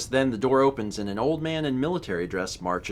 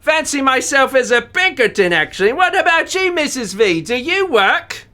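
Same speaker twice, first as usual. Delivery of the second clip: louder, higher hammed-up British chap; British accent; 11 semitones higher, 8 dB louder than usual. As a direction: play it as a jolly Englishman. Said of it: "With his accent".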